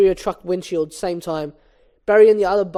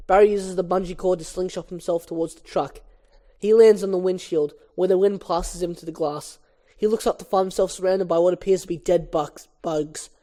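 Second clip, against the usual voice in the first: ordinary delivery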